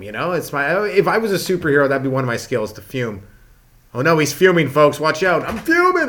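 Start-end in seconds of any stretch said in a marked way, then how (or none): 3.9-6.0 higher, louder announcer-style mock rage; playful hammed-up fury; mock fuming. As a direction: hype up your own fuming like a ringside caller. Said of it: "You just fume".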